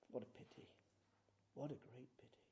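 very soft, almost whispered regret; hushed lament. Real, not acted. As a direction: drop to a near whisper in sorrow. Hushed lament after loud preaching.

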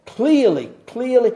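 "clearly" hammered twice, high and loud; repeated emphasis. Shown as a demonstration. Preacher repeating Paul's word, each "clearly" punched. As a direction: strike "clearly" each time. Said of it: "I repeat it over and over again".

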